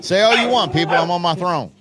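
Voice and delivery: high, loud smug dog on his throne; mock-royal dog boasting; dog king voice. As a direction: crow it out like a proud little king.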